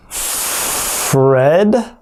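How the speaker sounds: Fred said slowly with the R stretched; slow broken-down word. Shown as a demonstration. Teacher breaking down the tongue twister slowly. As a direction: draw out Fred sound by sound. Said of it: "break the tongue twister down".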